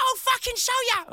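put-on high, whiny child protesting; a bullied boy yelling back in a squeaky voice; furious kid. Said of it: "He's like".

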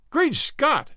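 high-pitched startled exclamation; gasped shock. A narrator's reading. Stedman interrupts his own reading of the cable, shocked. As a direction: break in, aghast. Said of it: "gasped Stedman".

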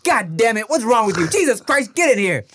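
put-on high, exasperated yell for the kid; exasperated parent yelling. Cosby's parents calling him in, exasperated yell. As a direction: call the kid in like a fed-up parent. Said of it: "my dad would be like".

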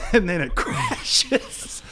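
laughing. Real, not acted. Laughing through the story.

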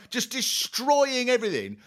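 normal talking voice

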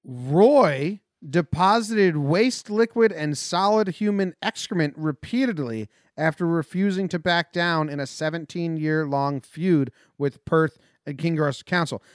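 slow, deliberate headline read; news read. Acted. Host reading strange headlines to his co-host, deliberate newsreader delivery. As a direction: read out odd headlines with measured emphasis.

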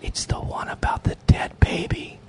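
slow secretive whisper; really quiet. Retold or imitated. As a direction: whisper it slowly like a shameful confession. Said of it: "She gets really quiet".

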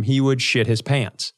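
ordinary talk, nothing marked

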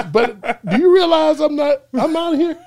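very high, incredulous comic plea; giggly, pretend-indignant deathbed voice; laughing mock protest. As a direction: squeal it through laughter in mock dismay. Jokes as the dying man.

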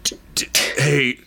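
hooked man forcing a cheerful greeting; wounded man in pain. Acted. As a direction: gasp it out through the pain. Dying man gasps in pain.

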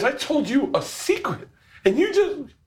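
high-pitched imitation of an indignant friend; mock outrage. Betrayed friend scolding the storyteller for leaking his secret, mock outrage.

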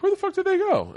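high-pitched baffled question; retold bewilderment. Telling how he found his friends gone, high and baffled.

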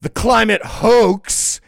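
crank's slow mock outburst; retold yell. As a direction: heckle it slowly and emphatically. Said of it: "stood up and yelled".

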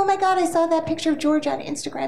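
high, fast gushing chatter; bubbly casting director. Retold or imitated. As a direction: gush like a chirpy casting director.

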